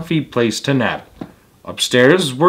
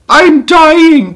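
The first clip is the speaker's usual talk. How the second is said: much higher, louder whiny cry rising to a squeal; Baby Bear crying; 7 dB louder than usual. Acted. Baby Bear at breakfast, wailing high and loud. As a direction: wail like a little cub who burnt his tongue. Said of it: "cried Baby Bear".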